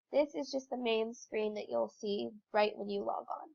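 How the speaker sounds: ordinary talk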